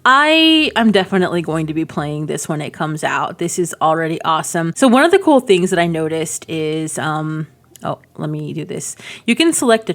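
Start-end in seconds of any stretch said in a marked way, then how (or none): none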